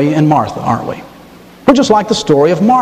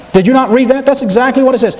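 ordinary talk, nothing marked

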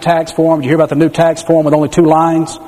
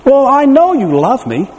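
put-on higher, slower, sweetly sure child; little girl's voice; 8 semitones higher than usual. Daughter assuring her father she knows he loves her. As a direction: answer like a trusting little girl. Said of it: "Oh, yes, Dad".

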